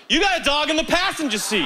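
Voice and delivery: high-pitched mock comeback; imitating woman driver. Woman driver after seeing his wife, high mocking comeback. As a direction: fire back high, imitating her retort. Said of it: "and she goes".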